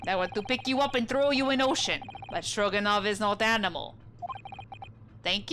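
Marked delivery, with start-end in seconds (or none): 0.1-3.9 put-on proud, broken English with a Russian accent; Russian sailor accent. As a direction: announce your name like a stern Russian crewman. Sailor threatens.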